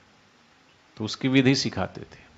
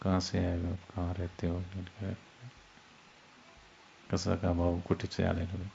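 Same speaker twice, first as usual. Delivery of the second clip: hushed whisper, much softer and lower; whispering demo; 5 semitones lower, 10 dB quieter than usual. Shown as a demonstration. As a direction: drop to a near whisper to show murmuring. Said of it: "कानाफूसी हो जाएगी ना".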